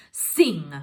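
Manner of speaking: sudden loud order to the harp; loud command. Acted. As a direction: bark the word at the harp. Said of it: "he said to the harp".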